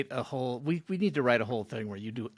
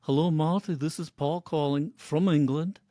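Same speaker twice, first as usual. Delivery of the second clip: a gentle Liverpool voice leaving a message; 3 semitones higher than usual. Retold or imitated. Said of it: "that's my [name]".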